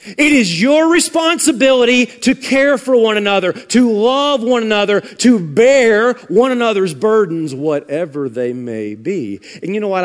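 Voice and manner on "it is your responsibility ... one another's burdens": high, emphatic pulpit exhortation; forceful preaching. Real, not acted. Preacher charging the congregation to care for one another, forceful preaching. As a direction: drive each charge home with weight.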